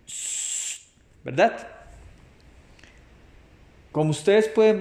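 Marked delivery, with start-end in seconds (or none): 0.1-0.8 a long soft unvoiced shh; shushing sound. Shown as a demonstration. Teacher showing kids the silence sign, soft shh. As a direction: hush them with a gentle shh. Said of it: "vamos a decir sh".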